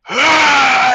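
long, high, loud war cry; yelled "aah" of a charging brute; battle yell. Acted. Burly half-orc barreling down the stairs, yelling. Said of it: "looks and he yells".

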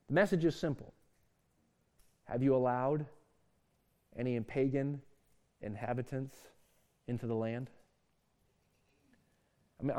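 tender pastoral appeal; quiet earnest appeal. Real, not acted. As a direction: draw the youth in gently. Quiet intimate application.

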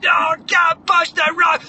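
high, loud cry of struggle; acted strained wail; shouted protest. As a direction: protest at full effort, hammed up. Moana protesting under strain.

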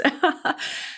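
short breathless self-mocking laugh; rueful laugh. Real, not acted. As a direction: laugh at yourself, airy and quick. Laughing at own meltdown.

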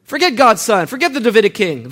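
loud, high, dismissive shouting; defiant rebel. Pretend. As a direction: reject the king with open defiance. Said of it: "What if I say".

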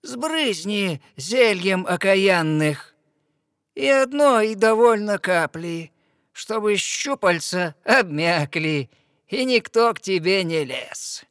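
the witch warning her slyly; sea witch. Acted. Witch gives advice on polyps.

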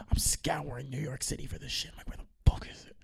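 hushed, exasperated whisper to yourself; acted-out frantic whisper; whispered desperation. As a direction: whisper it like a frustrated searcher. Acting out a desperate search.

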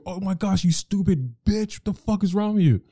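hammed-up furious rant; mock freak-out. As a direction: overact losing your mind. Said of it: "And I'll go crazy".

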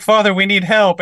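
put-on pleading kids. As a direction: beg like small children tugging a robe. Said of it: "they're like".

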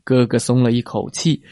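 usual speaking voice

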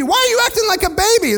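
high, mocking whine at a baby; mock scolding baby talk. Pastor mocking mature believers scolding the baby, high mock whine. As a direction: sneer it like a know-it-all talking down. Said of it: "turn around and say to the baby".

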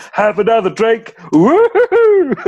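very high, loud party whoop; high, loud mock merrymaker at the wake; jovial drunken whoop. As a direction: cheer them on like a tipsy reveller. Witch singing jovially at a funeral.

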